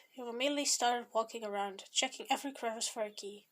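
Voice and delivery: usual speaking voice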